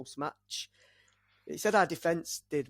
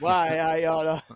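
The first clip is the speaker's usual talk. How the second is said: mock outrage; 9 dB louder, slower than usual. Mock fury at co-host.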